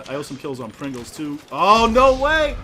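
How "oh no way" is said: high slow disbelieving shout; shocked yell. Real, not acted.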